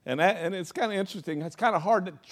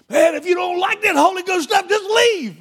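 much higher, much louder preacher yell; fired-up shout; 13 semitones higher, 9 dB louder than usual. Retold or imitated. Preacher yelling at the congregation, fired-up shout. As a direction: blast it like you're on fire in the pulpit. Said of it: "I'm like all fired up on the Holy Ghost".

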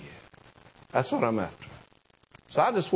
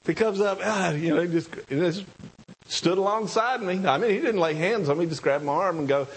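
ordinary talk, nothing marked

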